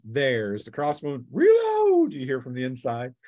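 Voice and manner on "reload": much higher, louder yell of command; shouted order. Acted. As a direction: bark it to the troops inside. Crossbowman shouts order inside.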